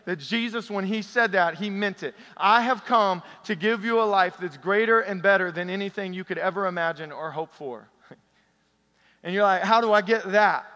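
loud, high preaching to the congregation; preaching at full force. Acted. Pastor rallying the church, preaching loudly. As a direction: lift the room with each line.

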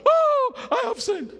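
very high, loud hammed-up cry; mock panicked wail. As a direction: wail the confession in exaggerated panic. Mocks a dethroned pastor's sudden confession.

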